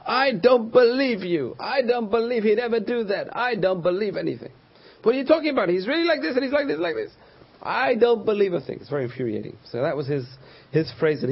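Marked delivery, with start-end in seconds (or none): 0.1-4.5 high, emphatic mock refusal; stubborn disbelief. Friend stubbornly refuses to believe gossip. 5.0-7.1 high-pitched, hammed-up arguing back; exasperated protest. Frustrated gossiper argues back. 7.6-8.8 high, insistent mock denial; stubborn disbelief. Friend again refuses to believe.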